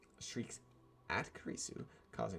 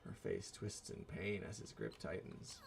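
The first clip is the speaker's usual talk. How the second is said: ordinary delivery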